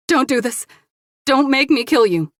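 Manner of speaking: high, loud frightened pleading; desperate plea. Acted. Woman holding a gun on her attacker, desperate. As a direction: beg him to stop, voice strained high.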